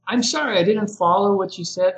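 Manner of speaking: apologetic "I'm sorry" lifted high, then easy. Shown as a demonstration. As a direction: gently take the blame on yourself. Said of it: "a little softer, uh, gentler".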